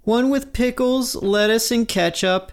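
high teacher voice ordering food; ordering at drive through. Retold or imitated. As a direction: read the order in a high, prim voice. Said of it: "Missus Dickey continues her order".

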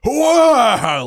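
put-on loud hacking cough like an old smoker; cartoon smoker hack; smoker's big cough. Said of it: "big old nineteen forties smoker".

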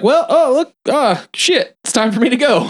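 high-pitched, hammed-up stalling; awkward excuse. Projectionist making excuses to get away from Pearl, awkward stalling. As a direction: imitate someone fumbling for a way out.

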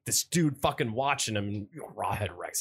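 ordinary talk

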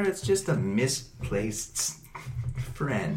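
soft, slow, lingering creepy voice; slow soft menace. Acted. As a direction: draw it out quietly, eerie.

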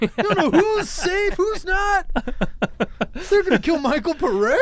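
very high, hammed-up alarm; mock panic. Host acting out viewers fearing for the star, mock panic. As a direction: cry it out high like a panicked fan.

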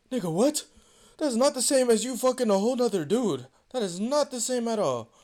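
high, heated incredulous protest; retold indignant objection. Rejecting his girlfriend's Tinder comparison, indignant. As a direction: argue back, high and emphatic.

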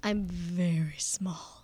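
tiny whispered admission. Real, not acted. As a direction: whisper it slow and small. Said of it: "is rather petite".

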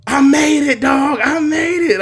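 loud, high, jubilant yell; dad's elated shout. Retold or imitated. Dad to son after the big call, elated high shout. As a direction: imitate dad bursting out in triumph. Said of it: "this is me impersonating my dad".

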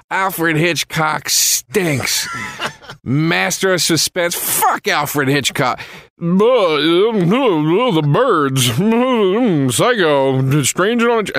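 slow, high, sneering rant against Hitchcock; scornful dismissal. Retold or imitated. Patrice trashing Hitchcock to bait Joe, in a slow sneering voice. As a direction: drag out the scorn, mocking the great director. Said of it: "Patrice was going".

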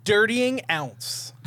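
drawn-out quizmaster reading; slow, deliberate clue. Shown as a demonstration. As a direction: lay out each word slowly. Reads out a puzzle clue.

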